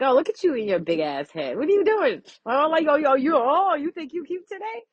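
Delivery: playful mocking tease; teasing roast. To someone they like, playful roasting tease. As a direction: rib them, mock-insulting. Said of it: "I like to tease".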